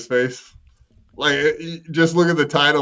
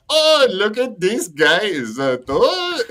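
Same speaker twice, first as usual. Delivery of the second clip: mock Nigerian accent; 8 semitones higher, slower than usual. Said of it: "be like".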